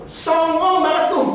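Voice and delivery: put-on high, slow, cocky voice of the teenage son; son's cocky remark. Son mutters about the rude lady, high and slow. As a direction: drawl it out like a snarky kid. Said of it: "katanya anak saya".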